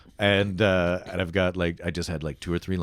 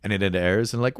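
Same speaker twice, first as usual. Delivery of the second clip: normal talking voice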